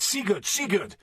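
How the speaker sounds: high, repeated battle cry; shouted war cry. A narrator's reading. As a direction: shout the name as you charge. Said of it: "we shouted".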